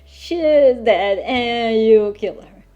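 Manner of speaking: ordinary delivery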